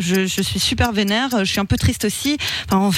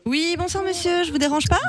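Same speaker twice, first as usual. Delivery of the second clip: sweet fake voice; 8 semitones higher than usual. Prank caller's sweet voice.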